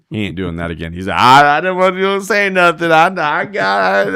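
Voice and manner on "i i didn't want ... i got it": high, loud, drawn-out wail; mock panicked protest. Partner protesting to roper about Randall, mock panicked wail. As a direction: stretch it into one long high whine.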